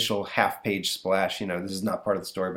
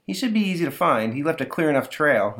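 mom voice; 6 semitones higher than usual. Acted.

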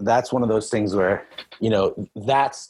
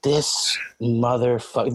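drawn-out, exasperated mutter; slow grudging curse; slower than usual. Retold or imitated. Lifter cursing his coach's mind game, slow and grudging. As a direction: say it slow, shaking your head at him.

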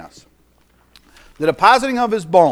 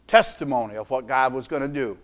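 ordinary talk

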